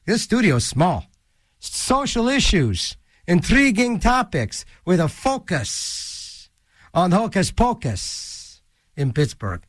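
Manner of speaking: usual speaking voice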